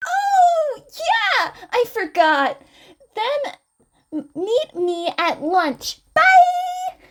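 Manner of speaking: put-on very high, loud excited girl; Mei rushing off. Mei realises and runs off, very high and excited.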